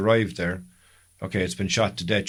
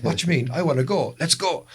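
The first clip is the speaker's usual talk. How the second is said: excited; 7 semitones higher than usual. Retold or imitated. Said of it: "We'd be going".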